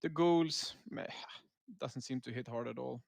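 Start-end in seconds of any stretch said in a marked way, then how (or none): none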